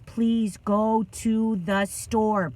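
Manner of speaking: very slow, word-by-word imploring; retold plea. Son begging his father by phone, slow deliberate plea. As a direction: spell out the plea one word at a time.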